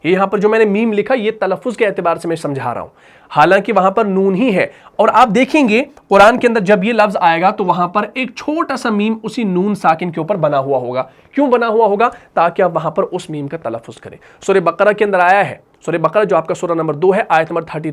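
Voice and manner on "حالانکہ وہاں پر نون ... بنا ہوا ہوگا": usual speaking voice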